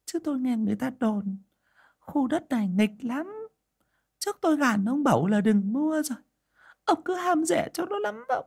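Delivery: high, thin wife's voice near tears; tearful old wife. A narrator's reading. Old wife fretting to her husband, high and teary. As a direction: plead with him, close to crying. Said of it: "rơm rớm nước mắt".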